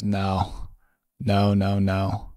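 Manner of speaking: low, deliberate no's with pauses; grave refusal. Retold or imitated. Editor shaking his head at the intern, low and dismissive. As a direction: imitate a boss's low, weighty dismissal.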